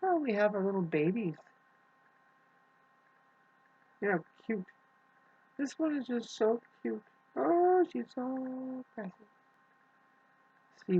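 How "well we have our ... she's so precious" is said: high, cooing pet voice; high coo with a long, melting "oh". Real, not acted. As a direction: gush tenderly over a tiny kitten. Gushing over her kittens.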